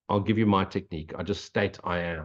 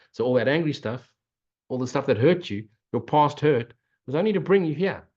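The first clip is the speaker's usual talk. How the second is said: fervent blessing; 6 semitones higher than usual. Acted. To listeners, ending the talk with an uplifted blessing. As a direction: lift the listeners up into the present moment. Closing blessing, rising fervour.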